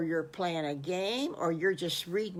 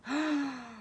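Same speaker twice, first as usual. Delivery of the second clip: gasp; 8 semitones higher than usual. Acted. Wolf delighted by invitation.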